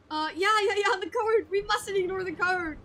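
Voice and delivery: a hint of a nervous lackey; eager sidekick. Underfoot eagerly agrees.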